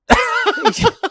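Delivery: a high, loud burst of laughter. Real, not acted. Laughing at his own story.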